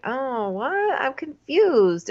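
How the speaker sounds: high, drawn-out clueless imitation; mock bewilderment. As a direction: play baffled, high and slow on confused.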